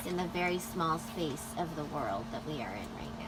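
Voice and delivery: hushed whisper to camera. Real, not acted. Mom narrating boys' mess.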